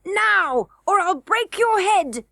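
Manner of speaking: high, slow, bold goblin prince; valiant cry. A narrator's reading. Crown prince threatening Curdie, slow and high. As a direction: puff up and command. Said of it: "cried the crown prince valiantly".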